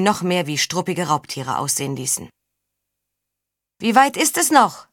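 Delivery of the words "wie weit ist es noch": high loud call forward. Acted. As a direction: call ahead to the leader. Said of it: "rief Tessa nach vorn".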